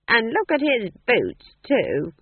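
slow, loud, high disapproval; critical. A narrator's reading. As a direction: find fault in an old witch voice. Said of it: "said Nanny critically".

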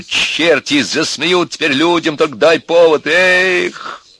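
high, slow, loud lament; exasperated outburst. A narrator's reading. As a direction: end in an agitated cry. Flaring up over family shame. (раздражённый громкий возглас)

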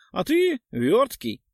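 high, slow, mocking; a touch of wry witcher. A narrator's reading. Witcher teases the dodgy ghoul, mock praise. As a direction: drawl out the compliment with a smirk.

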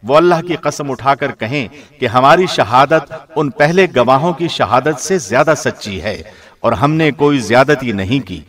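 normal talking voice

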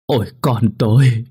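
high, loud, grief-stricken wail rising to a cry; keen over the lost child; sobbing wail. Acted. Father clutching his drowned child, wailing in grief. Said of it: "khóc nức nở".

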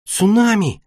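high, startled cry of a name; exclamation. A narrator's reading. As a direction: exclaim it high, taken aback. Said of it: "воскликнула". (удивлённое восклицание, высоким голосом)

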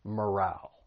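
morale ending in a short A sound, "muh-RAL"; short final vowel. Shown as a demonstration. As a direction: softly model morale with a short second vowel. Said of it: "but a short vowel sound".

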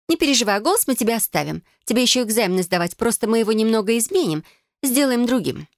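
Felicia's voice. Acted.

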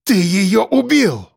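wrathful, weighted charge; angry accusation. A narrator's reading. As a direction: hurl the blame at him, drawing it out. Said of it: "Глаза наркомана вспыхнули гневом".

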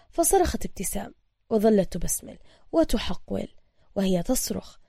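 plain reading voice

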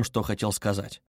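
plain reading voice

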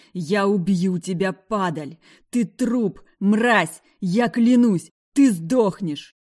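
loud furious yelling; furious threat. Acted. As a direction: roar the threat in rage. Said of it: "орал Джейс".

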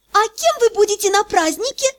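игривый кокетливый вопрос; спроси с заигрыванием; кокетливо. Acted. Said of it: "женский кокетливый вопрос". (кокетливый женский вопрос)

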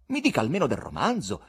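ordinary delivery, nothing marked

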